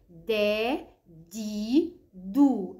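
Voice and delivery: دا، دي، دو as three drawn-out long vowels; letter sound demonstration. Shown as a demonstration. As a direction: sound out دا، دي، دو one at a time, firmly. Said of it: "بننطق الحرف بالفتح وبالكسر وبالضم".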